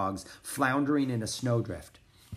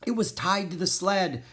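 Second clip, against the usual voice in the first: high, somewhat raised voice; shouting; 7 semitones higher than usual. A narrator's reading. Gunner explaining the lost package, mild shout. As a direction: protest in alarm. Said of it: "shouted Gunner".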